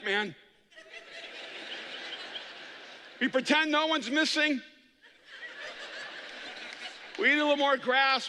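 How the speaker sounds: a hint of a weary herd animal; resigned gazelle. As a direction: shrug through the daily grind. Gazelle describes herd routine.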